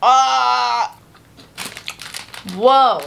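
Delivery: chewing while talking; muffled through food; mouth full. Real, not acted. Seen 1: Puffed cheeks and food-filled mouth while talking, cleared by about 3.6s.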